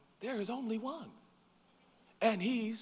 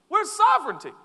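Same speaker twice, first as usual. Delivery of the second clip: loud challenge; 11 semitones higher, 9 dB louder than usual. Acted. Preacher to congregation, a loud high challenge. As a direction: shout the question out high.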